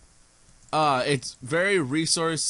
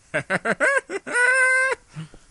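shrieking laugh; 6 dB louder than usual. Real, not acted. Laughing fit.

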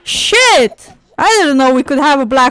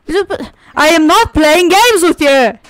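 louder, higher exasperated shout; angry yell; 4 semitones higher, 5 dB louder than usual. Real, not acted. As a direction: lose patience and yell it. Yelling at game character.

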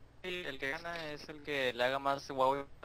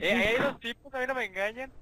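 higher and louder, alarmed; sudden alarm at spotting enemies; alarmado; 6 semitones higher, 5 dB louder than usual. Real, not acted. Spots two players, alarmed. (sorprendido y alarmado)

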